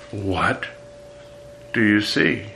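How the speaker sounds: "What" drawn out and rising, then a pause; stress on what. Shown as a demonstration. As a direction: open on a lifted "What", then "do you see?". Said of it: "It could be".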